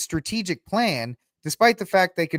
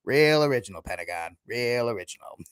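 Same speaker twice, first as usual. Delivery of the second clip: mock bad accent, dropping low and soft; Kevin Costner; 4 semitones lower, 3 dB quieter than usual. Host repeating Costner's jibe at the Pentagon, mock accent. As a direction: imitate a film line in a Mayor Quimby accent. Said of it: "His accent almost destroys that film".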